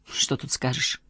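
breathy unvoiced mutter; whispered aside. A narrator's reading. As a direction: say it under your breath. Husband answers under breath.

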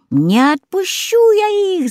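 high, slow witch screech; witch shrieking. A narrator's reading. Baba Yaga screeching at the goose, refusing to free the kids. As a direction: screech your refusal like a hag. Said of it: "завопила Баба-Яга".

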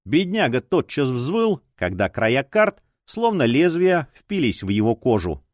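ordinary delivery, nothing marked

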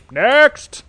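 high, loud cutting yell; shout. Acted. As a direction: bark it to end the audition. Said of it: "Santa shouted".

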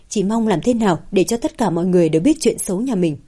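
plain reading voice

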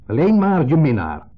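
loud, high, slow, minnaar thrown out; blurted out. Retold or imitated. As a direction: hurl the words out. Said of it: "Ik gooi het eruit". (eruit gegooid, luid en langzaam)

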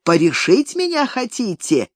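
plain reading voice

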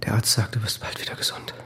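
soft reassuring whisper; calm her gently, barely voiced; soothing whisper. [name] reassuring his injured wife, whispered.

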